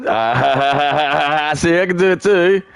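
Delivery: high, drawn-out mock cackle into words; mocking fake laugh. Streamer mimics the video at its speakers, mocking fake laugh. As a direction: squeal it through a put-on laugh.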